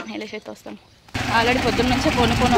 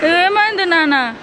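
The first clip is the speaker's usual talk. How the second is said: high, loud, slow cooing; cooing concern; 7 semitones higher, 7 dB louder, much slower than usual. Real, not acted. Mother asking crying son what happened, cooing concern. Comforting her crying child.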